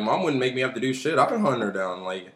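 ordinary delivery

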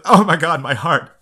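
high, loud melodramatic outcry; hammed-up swoon, half laughing; mock heartstruck. Host reacting to a little girl's question, mock overwhelmed.

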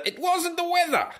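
high-pitched, hammed-up propaganda voice; mock North Korean newspaper. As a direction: declaim it high, as a mock state rebuttal. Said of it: "the newspaper, it read".

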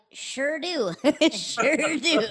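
high, laughing eager reply; grateful and giddy, laughing; laughing relief. Retold or imitated.

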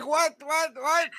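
high, repeated baffled what; shocked. Retold or imitated. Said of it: "And I'm like".

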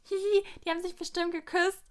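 teasing schoolgirl titter; giggly kid voice. Retold or imitated. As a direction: play your younger self snickering about a kiss. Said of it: "als Kind denkst du dir dann halt so".

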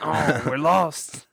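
hammed-up French accent, drawn out and loud; mock French lament. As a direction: wail it slowly like a lost Frenchman. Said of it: "You got a good French accent".

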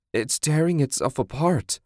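high, wavering moan of horror; distressed moan. Acted. Woman watching the monster destroy itself, moaning. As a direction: moan it in dismay. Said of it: "Susan moaned".

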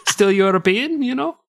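put-on French general insisting he's European in an Italian accent. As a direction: end on a high Italian shrug. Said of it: "I went Italian".

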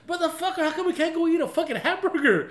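high, loud exasperated dad protest; mock outrage. Dad answering kids who want sushi, mock outrage. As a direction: complain loudly like a put-upon father, hammed-up.

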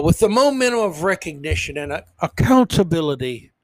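ordinary talk, nothing marked

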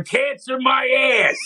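Philly fan dismissing the cancer excuse, mock yell; angry Philly fan shout. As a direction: bark it like a fed-up fan. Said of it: "a Philadelphia behind him goes".

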